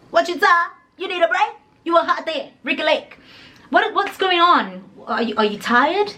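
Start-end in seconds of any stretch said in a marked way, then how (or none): 0.1-4.8 high, babying mock concern; baby her with high, playful questions; cutesy teasing. Storyteller asks sighing friend if she's tired, teasing high.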